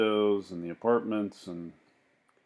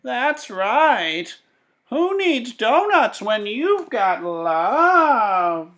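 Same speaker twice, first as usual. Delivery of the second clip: slow, much higher and louder heartfelt cry; sad old woman; 12 dB louder, much slower than usual. Acted. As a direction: proclaim it like an old woman who found love. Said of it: "she shouted".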